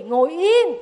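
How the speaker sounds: loud, sharp order to sit still; stern command. Acted. City woman ordering the sobbing boy to sit still, loud and stern. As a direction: snap it at a crying child.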